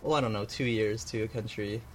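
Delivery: normal talking voice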